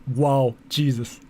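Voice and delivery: normal talking voice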